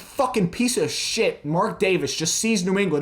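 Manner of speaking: loud, high angry rant; ranting angrily at the team owner; furious rant. Real, not acted. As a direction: tear into the owner with rage. Furious rant at owner Mark Davis.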